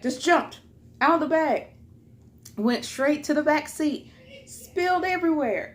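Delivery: high, emphatic disaster report; dramatic, indignant play-by-play of a spill; exasperated retelling. Real, not acted.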